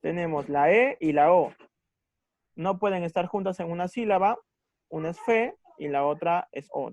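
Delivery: ordinary talk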